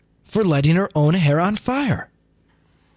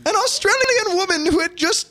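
high, wailing muddled British accent; hammed-up crying British-American accent; crying British-American woman; 11 semitones higher than usual. Shown as a demonstration. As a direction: read it as a tearful mock-British woman. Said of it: "like a crying British American woman".

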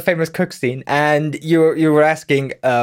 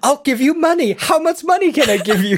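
much higher, urgent offering; frantic pleading; 10 semitones higher than usual. Retold or imitated. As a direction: beg in a rising panic, laughing.

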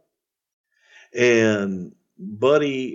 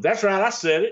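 higher cocky catchphrase; mimicking the catchphrase; 8 semitones higher than usual. The boys mimic Buddy after saying something stupid, smug higher voice. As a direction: toss it off like a smug heel. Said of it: "everybody started mimicking".

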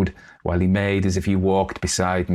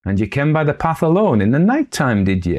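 stern exclamation; 7 semitones higher, 4 dB louder than usual. Acted. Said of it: "exclaimed old Mal Carke sternly".

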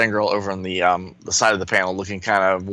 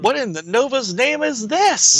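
alarmed hero voice; 13 semitones higher than usual. Acted. Sun Boy voiced in comic read.

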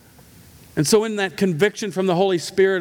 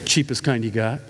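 curt; 8 semitones lower than usual. Retold or imitated. Grumpy reply to cashier.

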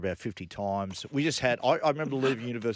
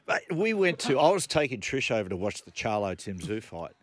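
ordinary delivery

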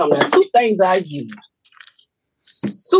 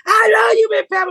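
fans shouting from the crowd; 12 semitones higher than usual. Retold or imitated. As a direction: yell it from the cheap seats.

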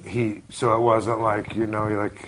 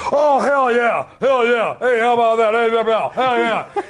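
mock-rowdy Texan yell, much higher than usual; loudmouth Texan; 9 semitones higher than usual. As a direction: whoop it up like a locker-room loudmouth. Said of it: "he was a loudmouth Texan".

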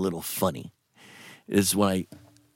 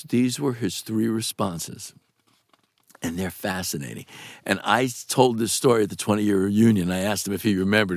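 usual speaking voice

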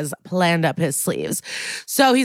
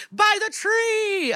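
very high, drawn-out playground call; slow, high-pitched imitation of a kid; 14 semitones higher, much slower than usual. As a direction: stretch it out like a child announcing. Imitating children announcing a wedding.

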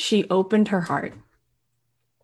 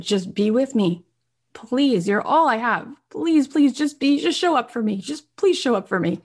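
urgent begging, pitch climbing on the pleases; pleading prayer; 5 semitones higher than usual. Retold or imitated. She prays to God before going out, pleading. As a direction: act out a desperate plea, higher-pitched. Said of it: "and she prayed to God".

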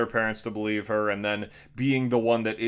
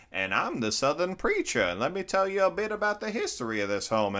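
lightly voiced preacher; 8 semitones higher than usual. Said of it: "weird B characters".

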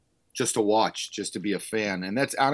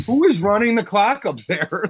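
higher, louder, incredulous; exasperated outburst; 10 semitones higher, 7 dB louder than usual. Retold or imitated. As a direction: vent at the clock operator. Exasperated at clock operator.

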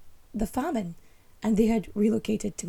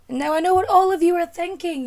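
loud, big announcer voice; booming announcer; 8 semitones higher, 9 dB louder than usual. Pretend. Host booming to the audience, loud announcer voice. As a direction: boom it out like a showman.

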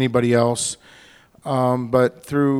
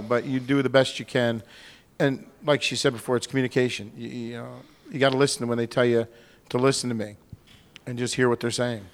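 normal talking voice

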